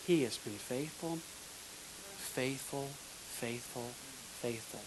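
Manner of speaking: sincere testimony trailing into near silence; fading whisper. Real, not acted. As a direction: let it fade to a hush. Repeating faithful, fading away.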